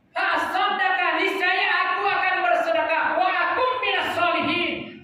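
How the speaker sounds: high preacher's recitation of a plea; preached plea. Acted. Preacher voices the dying man's promise of alms, high and urgent.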